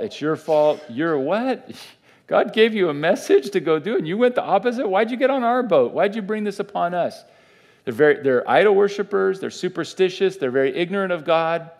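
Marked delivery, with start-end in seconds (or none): 0.0-7.2 high-pitched, incredulous sailors' outburst; mock-outraged crewmen, pitch climbing high; indignant accusation. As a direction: confront him in raised, disbelieving questions. Acting out sailors accusing Jonah.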